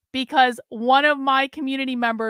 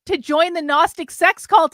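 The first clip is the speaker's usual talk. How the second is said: loud pleading cry; cried out; 4 semitones higher, 6 dB louder than usual. Acted. James cries out to Sophie, loud and pleading. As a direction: blurt it out loud. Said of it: "he cried".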